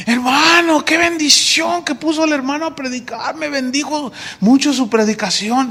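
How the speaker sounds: a hint of an excited churchgoer; gushing member. Congregation praises new preacher. (feligrés entusiasmado, más agudo)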